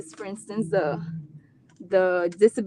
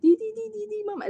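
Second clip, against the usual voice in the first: put-on high, babbling child's syllables; baby talk; 12 semitones higher, faster than usual. Mom shows how her nonverbal son babbles, high baby talk. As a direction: imitate a toddler's gibberish, pitched way up. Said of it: "baby talk".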